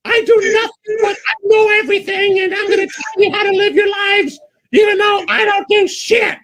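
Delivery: very high, self-important mock wail; hammed-up high-pitched diva shouting; Sophie impression. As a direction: drag out the opening words in a shrill screech. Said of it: "is Sophie's Choice".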